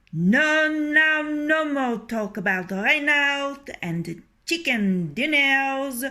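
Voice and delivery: loud, high, boastful rooster voice; proud rooster. Acted. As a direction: crow it out, full of himself. Boastful rooster brushing off worry.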